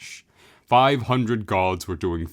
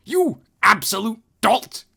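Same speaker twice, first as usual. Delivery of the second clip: high, loud contemptuous shriek; furious insult; 7 dB louder than usual. Acted. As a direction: screech the insult. The Hierophant insults Ozma.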